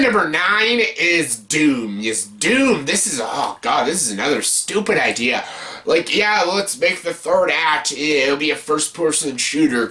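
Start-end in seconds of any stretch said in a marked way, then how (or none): none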